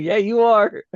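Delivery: teasing insistence. Retold or imitated. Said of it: "I'm like".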